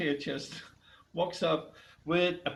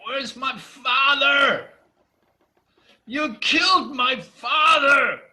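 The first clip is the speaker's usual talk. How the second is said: furious high shout, words drawn out; screaming anger; 11 semitones higher, 10 dB louder, slower than usual. Acted. Furious giantess confronting her father's killers, screaming in anger. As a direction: roar it out in drawn-out rage. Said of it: "screaming her anger out".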